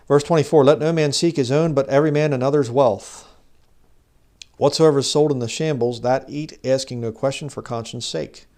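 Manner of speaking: loud, declamatory Bible reading; scripture reading. Acted. Reads scripture aloud to the congregation.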